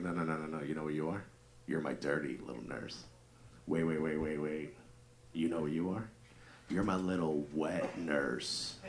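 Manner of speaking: low, soft, hammed-up bedroom voice; dirty talk. Husband in bed building up pet names, mock dirty talk. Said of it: "I tried to dirty talk my wife".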